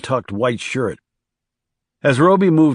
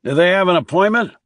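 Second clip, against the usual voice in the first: higher, quick raised voice; calling out; 8 semitones higher, 4 dB louder, faster than usual. A narrator's reading. Boss questions hostess through office door, calling out. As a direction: call through a closed door.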